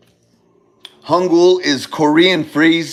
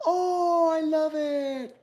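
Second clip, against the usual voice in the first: fake polite thanks; 9 semitones higher, 9 dB quieter, much slower than usual. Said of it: "than like".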